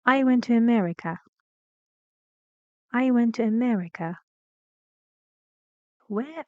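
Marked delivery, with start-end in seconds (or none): 0.0-4.2 brisk model sentence, falling on "America"; quick, clear teacher's example with "America" falling; falling intonation. Shown as a demonstration. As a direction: drop the tune through "America" to the end. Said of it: "I think falling".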